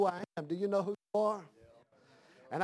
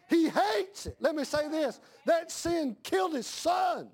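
preaching shout; 7 semitones higher, slower than usual. Real, not acted. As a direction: cry it out to the congregation, slow and high. Preacher shouting about sin.

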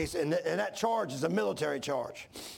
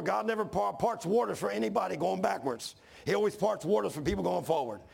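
ordinary talk

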